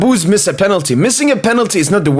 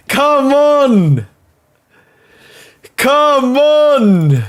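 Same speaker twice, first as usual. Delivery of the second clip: raised, high come on with a falling end; urging shout; 6 semitones higher, much slower than usual. Real, not acted. Fan urging his team at the screen, raised pleading shout. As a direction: push the team on from the sofa.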